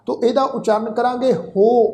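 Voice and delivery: ordinary talk, nothing marked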